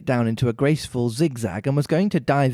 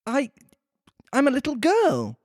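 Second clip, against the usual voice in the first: doubtful Alice; 11 semitones higher than usual. A narrator's reading. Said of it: "rather doubtfully".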